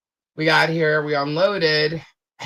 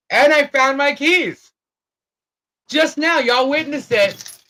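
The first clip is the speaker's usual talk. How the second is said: delighted discovery; 9 semitones higher, 4 dB louder than usual. Real, not acted. Finding lost keys mid-video.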